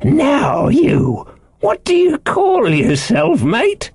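high-pitched marooned sailor; eccentric castaway, curious. A narrator's reading. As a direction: question him in a high castaway voice. Ben Gunn's voice.

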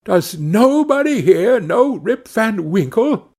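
high, slow, desperate appeal; despairing cry. A narrator's reading. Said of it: "but cried out in despair".

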